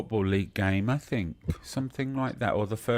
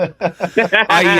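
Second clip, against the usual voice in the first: laughing; 10 semitones higher, 11 dB louder than usual. Real, not acted. Laughs after caller's jibe.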